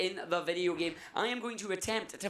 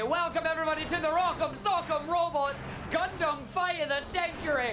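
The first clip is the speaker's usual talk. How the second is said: fight announcer; 8 semitones higher than usual. Acted. Announcer hypes a mock fight.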